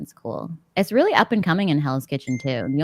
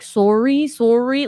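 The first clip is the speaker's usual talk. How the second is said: higher, louder Canadian "sorry"; really Canadian; 6 semitones higher, 5 dB louder, much slower than usual. Retold or imitated. Retelling a Bachelor contestant's fight, imitated Canadian accent. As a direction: imitate a Canadian "sorry" with a long "sore" vowel. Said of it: "like, really Canadian".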